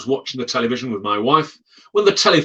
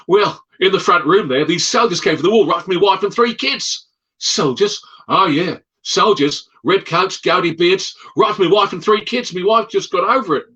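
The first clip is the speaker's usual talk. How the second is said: put-on agitated Australian accent; 5 semitones higher than usual. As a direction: tell of the soldiers in a pushed-up Australian voice. Australian describes the ghost soldiers.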